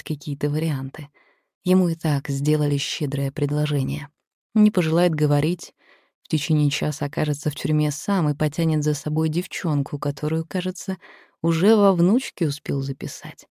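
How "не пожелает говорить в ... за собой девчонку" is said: ordinary delivery, nothing marked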